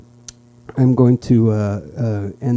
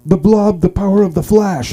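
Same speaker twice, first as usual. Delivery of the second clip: high, loud baffled outcry; confused disbelief; 9 semitones higher, 5 dB louder than usual. Acted. Smaller guys outrun by the big man, confused. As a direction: act out baffled amazement. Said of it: "they were confused".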